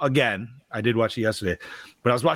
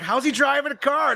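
incredulous; 12 semitones higher than usual. Retold or imitated. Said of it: "I'm just like".

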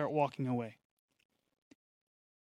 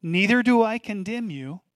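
proclaimed; 4 semitones higher, 7 dB louder than usual. Acted. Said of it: "I wanna say that again".

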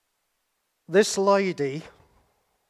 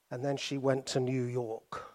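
ordinary talk, nothing marked

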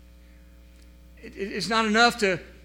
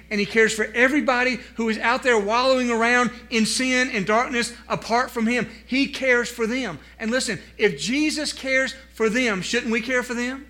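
louder, higher urging; emphatic appeal; 5 semitones higher, 5 dB louder than usual. Acted. Preacher urging the congregation to care, emphatic. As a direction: press the point home.

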